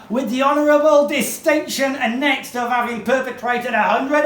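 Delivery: high, loud, hammed-up ranting; Charles Dickens. Dickens railing at plagiarists from the podium, furious shouted oratory. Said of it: "That's Charles Dickens speaking".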